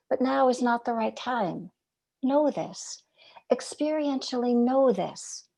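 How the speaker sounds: ordinary talk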